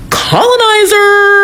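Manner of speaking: high, slow sing-song answer leading the class; teacher call-out. Pretend. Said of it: "Say it with me, class".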